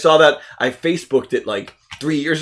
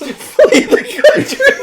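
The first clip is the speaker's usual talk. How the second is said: high, slow, laughing wail of flee the country; laughing cry; 3 dB louder, much slower than usual. Acted. Podcast host laughing as he calls to flee the country. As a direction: stretch it out through laughter. Mock dramatic repeat, laughing.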